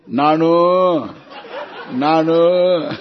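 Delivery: loud, stretched-out name call; calling a nickname. Acted. Parents call Narayana by his short name, loud mock calling. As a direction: call out the pet name with long vowels. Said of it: "which Narayana is called Narayana?".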